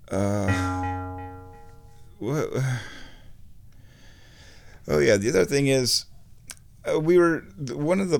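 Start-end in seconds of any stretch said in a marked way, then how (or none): none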